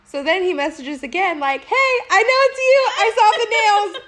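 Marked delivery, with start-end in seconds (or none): 1.6-4.0 high, loud, gleeful accusation; mock pestering man. Man messaging that he recognised her, mockingly insistent. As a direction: imitate a pest crowing over his discovery.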